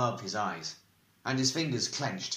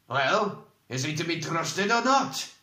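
higher-pitched insistent question; impatient demand; 6 semitones higher, 4 dB louder than usual. A narrator's reading. Questioner demanding a verdict on whether he can be trusted, impatient. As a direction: press hard for an answer.

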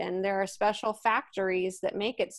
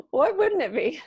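laughing through the words, higher; 7 semitones higher, 5 dB louder than usual. Real, not acted. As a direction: giggle it out, delighted. Author delighting in her character.

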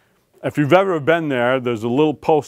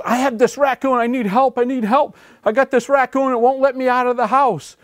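high, frantic pleading woman's voice; a frightened neighbour begging the warden for help; distraught lady; 11 semitones higher than usual. Retold or imitated. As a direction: beg for help in a panicked high voice. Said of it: "a very distraught lady".

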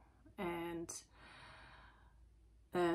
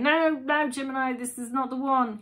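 higher, louder insistent inner warning; your gut shouting stop; gut instinct warning; 5 semitones higher, 8 dB louder than usual. Pretend. Gut instinct warning Gemini listener, higher and louder. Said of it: "your gut instinct's like saying".

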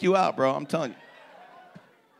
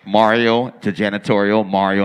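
mock intercom page; 8 semitones lower, 11 dB louder than usual. Paging over store intercom.

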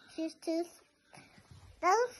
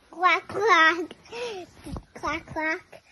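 loud quacking; 2 semitones higher, 10 dB louder, much slower than usual. Pretend. Child quacks loudly.

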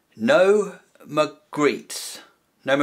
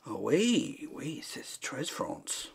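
mock French; 8 dB quieter, slower than usual. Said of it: "Is that French?".

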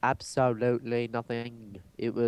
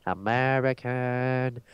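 slow, stretched-out mock American accent; exaggerated American accent; 2 semitones higher, much slower than usual. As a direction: drag out every syllable in broad American. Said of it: "I'm just American".

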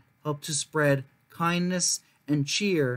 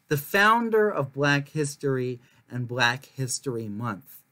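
usual speaking voice

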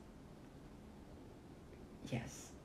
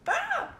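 very high shriek; scream; 5 dB louder than usual. Real, not acted. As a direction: shriek in fright. Sudden event.

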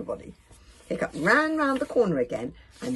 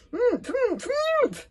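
high, loud mm-ing through food; desperate muffled grunts; 6 dB louder, much slower than usual. A narrator's reading. Dragon trying to stop friends through cookies, muffled grunts. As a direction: protest with mouth crammed full. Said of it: "desperately munching at the fortune cookies".

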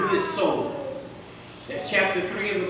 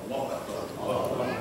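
much softer and lower, Allahu Akbar with a rising end; hushed Allahu Akbar; 11 semitones lower, 11 dB quieter than usual. Real, not acted. Preacher calming down, murmuring Allahu Akbar softly. Soft call after fervent preaching.

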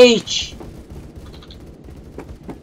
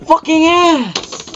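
high, slow, stretched-out taunt; mocking drawn-out insult; 11 semitones higher, much slower than usual. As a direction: stretch every syllable to mock.

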